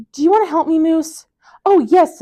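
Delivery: put-on high, loud cheerful child voices; bright eager kids. A narrator's reading. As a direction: sound delighted to share. Franklin and Moose, friendly dialogue.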